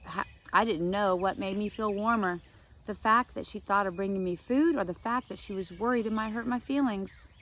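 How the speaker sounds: usual speaking voice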